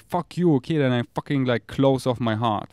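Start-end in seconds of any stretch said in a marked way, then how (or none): 0.0-0.8 loud, high burst of defiance; heated, raised-voice comeback; defiant retort. Retold or imitated. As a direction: re-enact lashing back at the haters. Acts out his angry retort.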